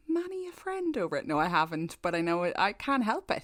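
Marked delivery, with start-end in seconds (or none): none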